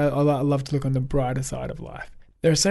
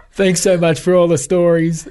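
laughing through a retold quote, louder; 5 semitones higher, 8 dB louder than usual. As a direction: say it while still laughing. Quoting a fan, laughing.

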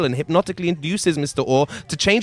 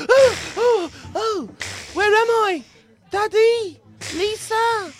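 put-on high, gasping boy voice; frightened boy; slower than usual. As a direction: wake up scared and lost. Boy waking up confused.

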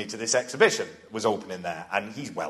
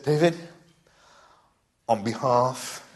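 slow, grand tribute voice; solemn slow gushing; slower than usual. Retold or imitated. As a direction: deliver it like an awards speech, slowly. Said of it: "I could hear myself".

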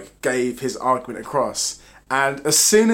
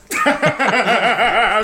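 bright burst of laughter; loud laugh; 9 semitones higher, 6 dB louder than usual. Real, not acted. Laughs at the joke.

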